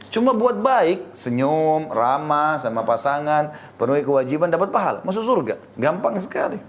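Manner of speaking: excited insistence. Real, not acted. Preacher tells couples heaven is easy through kindness, excited. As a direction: sell it as the easiest thing in the world.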